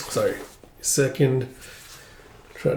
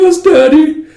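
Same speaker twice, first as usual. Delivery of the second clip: put-on much higher, louder, obedient kid voice; eager boy; 8 dB louder, slower than usual. Son to his father, an eager high obedient reply. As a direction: snap to attention for his father.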